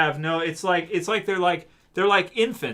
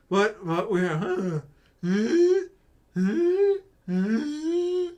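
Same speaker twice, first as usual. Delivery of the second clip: clueless zombie voice; 6 semitones higher, much slower than usual. Pretend. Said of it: "My response is".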